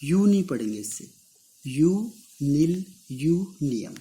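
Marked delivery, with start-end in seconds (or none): 1.7-4.0 यू नील यू नियम said slowly, root by root; each root separate. Shown as a demonstration. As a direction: break यू नील यू नियम into parts. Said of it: "separate". (हर root अलग अलग, धीरे)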